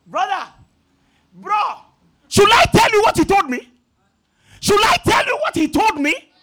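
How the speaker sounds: calling out loud and high across the hall; retold scream. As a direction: yell after him, voice rising. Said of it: "Then I started screaming".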